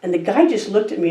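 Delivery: usual speaking voice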